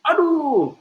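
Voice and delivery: high, loud cry of pain; loud yell. Acted. A child who stubbed his toe on a mountain yells out loud in pain. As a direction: yell out "Aduh!" as a hurt child. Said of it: "Maka dia teriak dengan kencang".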